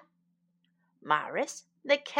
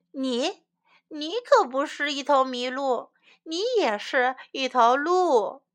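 plain reading voice

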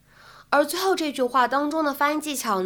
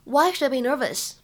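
ordinary talk

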